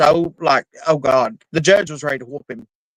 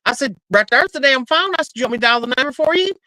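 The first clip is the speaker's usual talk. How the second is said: retold dare to son; 10 semitones higher, faster than usual. Mother retells dare to son.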